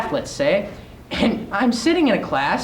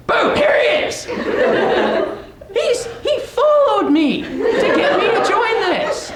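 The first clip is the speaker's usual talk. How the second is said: excited; 10 semitones higher than usual. Real, not acted. Teacher surprises him in class.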